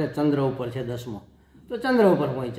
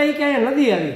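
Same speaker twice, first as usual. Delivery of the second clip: put-on child's voice; 8 semitones higher, 6 dB louder than usual. Child asks grandfather about river.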